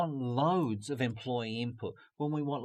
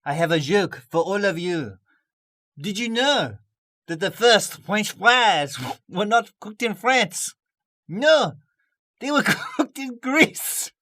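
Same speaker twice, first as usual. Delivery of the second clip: put-on higher, louder French-accented joke, slow, laughing through it; hammed-up French accent; 8 semitones higher, 11 dB louder than usual. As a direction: tell the joke like a cheeky Parisian host. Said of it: "in my best Parisian French accent".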